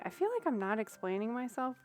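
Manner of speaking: normal talking voice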